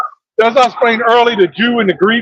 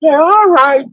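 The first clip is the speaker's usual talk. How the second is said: higher-pitched mock-coy agreement; coy reply; 5 semitones higher, slower than usual. Woman answering a man's pickup line, coy reply. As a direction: imitate her drawing out a willing yes.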